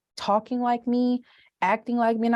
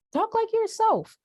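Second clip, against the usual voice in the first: high voice; 7 semitones higher than usual. Retold or imitated. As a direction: sing it out high. Quoting her mantra.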